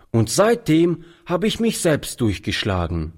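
high, lightly voiced boy stating it plainly; lightly voiced street boy, plain statement; boy's matter-of-fact line. A narrator's reading. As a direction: say durchgeschlagen as a simple fact, no surprise. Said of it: "wiederholte der Alte".

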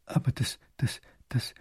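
breathless whispered stammer; stunned stutter. Acted. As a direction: whisper the stammer, struck speechless. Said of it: "kann der bleich gewordene Großherzog nur stottern".

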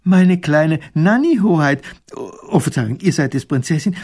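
erfreut und herzlich, höher; viel freundlicher. Acted. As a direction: der Soldat erkennt die Prinzessin wieder, freudig. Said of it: "Seine Stimme ist auf einmal ganz anders und viel freundlicher". (Soldat begrüßt die Prinzessin herzlich und freudig)

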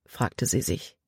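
usual speaking voice

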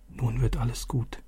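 put-on girl's hopeful thought said softly; quiet thought. A narrator's reading. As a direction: think it to yourself, hushed. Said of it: "dachte sie".

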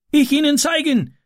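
very high eager cry; urgent call. A narrator's reading. Ram offers to guide the man, high urgent call. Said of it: "rief Ram".

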